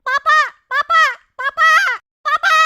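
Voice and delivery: put-on loud, high child calling "papa"; squeaky kid yelling for dad; repeated high-pitched calls for help; crying out for father. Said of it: "काहे चिल्ला रहा है".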